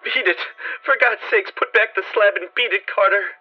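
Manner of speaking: high, loud desperate plea; piteous cry. A narrator's reading. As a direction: cry out in terror for him to run. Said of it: "a piteous cry from Warren".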